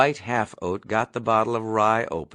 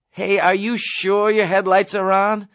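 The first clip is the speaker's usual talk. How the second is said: higher voice calling forward; Nately calling out to the driver, raised voice; 8 semitones higher, 4 dB louder than usual. Acted. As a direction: call it to the front seat. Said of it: "Nately called out".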